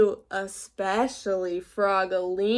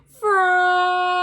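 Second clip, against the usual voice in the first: much higher, louder drawn-out call; teacher's sharp call; 8 semitones higher, 10 dB louder than usual. A narrator's reading. Teacher Missus Witherspoon scolding Froggy, loud sharp call. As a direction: call his name to snap him back. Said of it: "cried his teacher".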